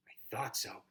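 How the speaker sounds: breathy unvoiced whisper. The hero whispers to friends, quiet and hushed. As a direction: say it under the breath.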